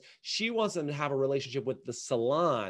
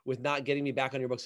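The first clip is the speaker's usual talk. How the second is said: usual speaking voice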